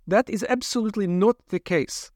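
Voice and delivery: lightly voiced brisk scientist; a hint of a cool, reasoning doctor.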